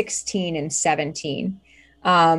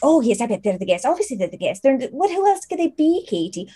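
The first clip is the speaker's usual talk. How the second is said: high, quick mocking tone; a young maid rolling her eyes; sarcastic teen maid; 6 semitones higher, faster than usual. GM voicing Teresa mocking Katie, high and sarcastic.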